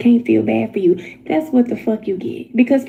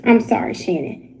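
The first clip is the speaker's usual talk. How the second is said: usual speaking voice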